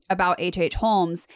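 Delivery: ordinary delivery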